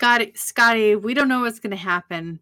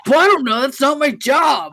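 mock shout; 4 semitones higher, 5 dB louder than usual. Bones voiced, loud.

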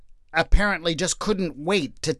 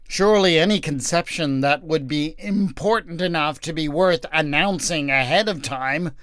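usual speaking voice

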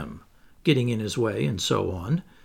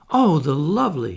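higher, louder exclamation of delight; delighted cry; 4 semitones higher, 5 dB louder than usual. A narrator's reading. Wendy seeing the fairy at rest, delighted cry. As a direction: cry out in admiration, voice lifted. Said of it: "she cried".

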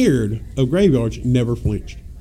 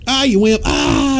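mocking; 8 semitones higher, 3 dB louder, slower than usual. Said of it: "I said".

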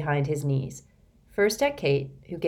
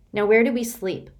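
put-on impatient girl; 6 semitones higher, 6 dB louder than usual. Tired demanding girl.

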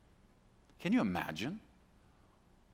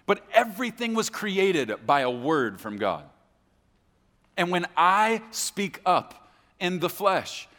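loud, raised pulpit proclamation; full-force preaching; 7 semitones higher, 8 dB louder than usual. Real, not acted. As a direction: drive each phrase home with force.

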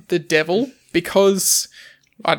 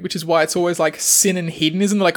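ordinary talk, nothing marked

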